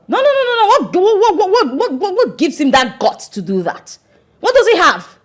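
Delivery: high, stammering indignation; indignant outrage. Acted. Daughter hearing he walked out on her father, outraged. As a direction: sputter in disbelief, pitch jumping up.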